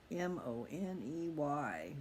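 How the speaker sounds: M O N E Y slowly, letter by letter; deliberate spelling of money; spelled out. Shown as a demonstration. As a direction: spell the word out to drive it home.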